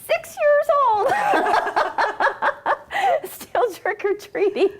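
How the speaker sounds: high, loud squeal breaking into laughter; laughing protest. Real, not acted. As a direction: own up loudly through helpless laughs. Mock protest at being teased about age.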